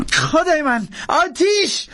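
high-pitched cry of alarm; panicked shout. Acted. Jackson as the stove explodes, high panicked shout. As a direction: yell it out way up high. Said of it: "جکسن فریاد زد".

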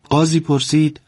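ordinary delivery, nothing marked

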